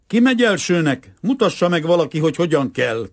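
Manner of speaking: calling out. A narrator's reading. German boy asks for someone to show how, calling out. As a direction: shout it up and out. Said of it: "kiáltotta egy hosszú, szemüveges német fiú".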